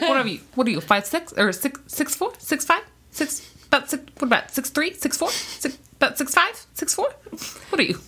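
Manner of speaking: mock host grilling Peyton Manning on his height; talk show host bit. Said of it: "it's like their talk show".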